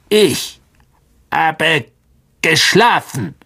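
high, clicking spider, words broken apart; Aragog, irritated. A narrator's reading. The old spider woken from sleep, irritated clicking voice. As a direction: click out the complaint word by word, testily. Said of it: "klickte Aragog gereizt".

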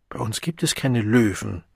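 usual speaking voice

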